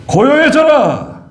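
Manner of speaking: high, slow commanding call to the sea; commanding rebuke. Acted. 예수님이 바다를 꾸짖는 장면. (높고 느리게 꾸짖듯 명령하는 목소리)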